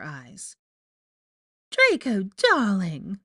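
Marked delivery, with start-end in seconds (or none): 1.7-3.2 Rita Skeeter, falsely chipper. A narrator's reading. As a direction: greet him with put-on delight. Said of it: "exclaimed in her falsely chipper voice".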